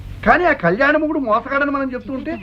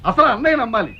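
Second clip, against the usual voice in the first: usual speaking voice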